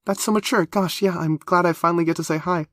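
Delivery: high, eager, bubbling over; breathless excitement. A narrator's reading. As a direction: gush like she can barely contain herself. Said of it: "still a bit out of breath from sheer excitement".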